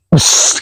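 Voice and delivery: one short, sustained "wes" hiss; calm single whoosh. Shown as a demonstration. Describing the steady bird's descent, single imitated whoosh. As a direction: imitate a smooth bird dropping in one whoosh. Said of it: "kalau yang anteng biasanya itu".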